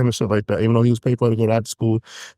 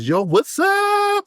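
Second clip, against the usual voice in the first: very high, slow, stretched-out hype greeting; over-the-top greeting; much slower than usual. Retold or imitated. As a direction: call it out like a big hype hello. Mimicking Justin's greeting.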